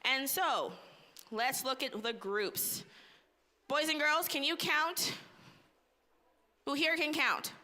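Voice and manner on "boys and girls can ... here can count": put-on bright, high, upbeat questions to children; kids show host. Quizzes kids on counting.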